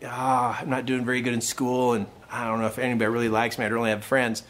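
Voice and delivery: low, mumbled self-doubt; low-energy son. Retold or imitated. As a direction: sag into a downcast kid's voice. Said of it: "his energy was really small".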